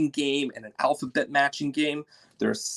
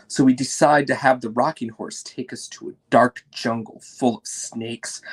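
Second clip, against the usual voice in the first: plain reading voice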